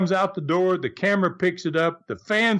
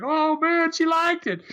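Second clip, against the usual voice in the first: crowd reaction; 11 semitones higher than usual. Retold or imitated.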